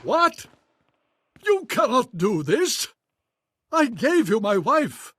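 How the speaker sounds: high, swooping indignant cries; shocked and affronted; outraged protest. Acted. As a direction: protest the betrayal in disbelief. Baron protests being betrayed.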